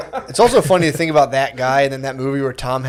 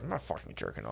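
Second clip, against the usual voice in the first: much lower and far softer; muttered mock denial; 12 semitones lower, 24 dB quieter than usual. Old-time sailor denies it to his shipmates, low and hushed. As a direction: mumble the denial under the breath. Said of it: "guys who were like".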